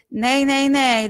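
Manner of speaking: bright "nay" with forward placement; twang. Shown as a demonstration. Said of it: "un petit peu de twang".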